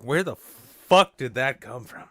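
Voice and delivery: loud, drawn-out astonished outburst; baffled amazement. Acted. Performer coming out of the zone, loudly baffled. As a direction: stretch it out slowly and loudly in amazement. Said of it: "you're like".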